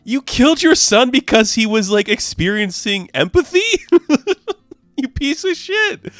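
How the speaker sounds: high, loud accusation cracking into laughter; squealing mock outrage through giggles; laughing outrage. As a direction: accuse him while losing it laughing.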